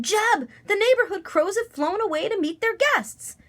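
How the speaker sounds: high, urgent call; shouting. A narrator's reading. Father alerting his son at sunset, high shout. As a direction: call out the news, pitched high. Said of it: "Daddo shouted".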